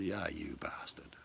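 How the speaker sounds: slow, whispered retort; muttered aside. Retold or imitated. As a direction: breathe it under your breath. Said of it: "they turned and said".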